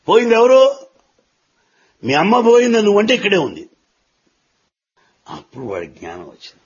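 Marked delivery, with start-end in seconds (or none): 0.1-3.7 put-on an old man asking loudly and higher, then rushing on; pointed elder's question. Wise elder pressing the crying boy, loud and quick. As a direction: challenge the boy with a sharp question. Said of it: "ఈ పెద్ద ఆయన అడిగాడు".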